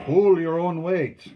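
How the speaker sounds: loud, high, slow and weighty; emphatic advice. A narrator's reading. Father advises his son, emphatic. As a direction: drive each word home.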